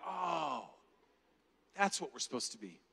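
low, soft awe; softly recalled moment of wonder; hushed realization. Retold or imitated. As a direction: let the realization sink in quietly.

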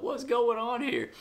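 high, startled cry of confusion; startled crowd. Retold or imitated. Onlookers staring up at the torn roof, startled and high. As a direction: voice the puzzled crowd, pitched high.

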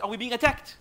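high, loud, alarmed retold question; panic-stricken. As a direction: retell a panicked man's urgent question. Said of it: "That's how panic-stricken the man was".

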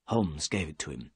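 ordinary delivery, nothing marked

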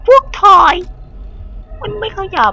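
very high panicky squeal; frightened squeal. Real, not acted. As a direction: shriek as the toys close in. Screaming at toys in game.

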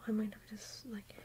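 normal talking voice

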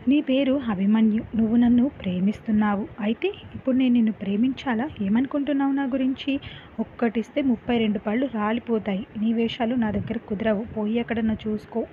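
plain reading voice

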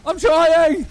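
panicking gamer. Real, not acted. Ambushed, no health.

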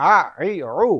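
আ, ই, উ squeezed from mid-throat; pressed ain vowels. Shown as a demonstration. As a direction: press আ, ই, উ out firmly. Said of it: "চাপিয়ে পড়ো". (চাপিয়ে আইনের আ ই উ)

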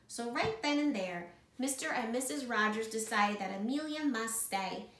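ordinary delivery, nothing marked

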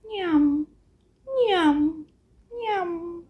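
munch like an ox on grass; munching sounds. Acted. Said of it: "I see an ox eating grass".

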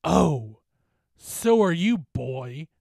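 loud, drawn-out taunt falling at the end; menacing specter. Acted. Specter holding the bully, taunting back. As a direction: answer with gloating menace.

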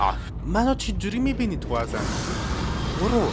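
loud high startled cry; alarmed shout. Real, not acted. Player spotted by the monster Golnaz, alarmed shout.

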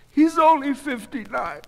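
high, loud, distressed objection; protesting wife. A narrator's reading. Goldie arguing her husband's age with the doctor, distressed.

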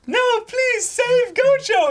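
very high, drawn-out mock pleading; hammed-up desperate fan wail; begging fans. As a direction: plead in a squeaky, loud falsetto. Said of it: "everyone, like, is begging".

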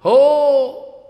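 long, high, loud acted wail; crying out. Jayadev Goswami running and gone mad, crying out. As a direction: let out a drawn-out overwrought cry. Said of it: "running and crying".

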